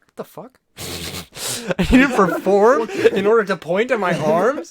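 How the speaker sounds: what the fuck, loud and incredulous; incredulous outburst. Real, not acted. Outraged at the performance check.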